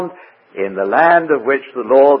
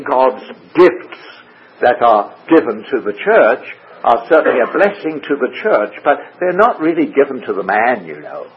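ordinary delivery

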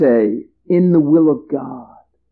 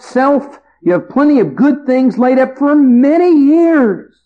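higher, louder, gloating self-talk; a rich farmer praising himself, higher and louder; smug farmer to himself; 9 semitones higher, 3 dB louder than usual. Acted. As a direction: crow about your plenty to yourself. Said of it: "The man says to himself".

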